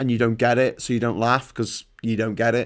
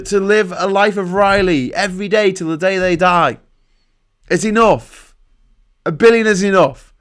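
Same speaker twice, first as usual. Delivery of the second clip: higher, louder, insistent political rant; impassioned rant; 6 semitones higher, 4 dB louder than usual. Real, not acted. Streamer arguing against billionaires to his viewers, impassioned. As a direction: hammer the point home with rising indignation.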